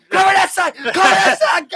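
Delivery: high, loud command yell; shouted orders. Retold or imitated. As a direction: shout it over gunfire to your squad. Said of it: "And I'm like".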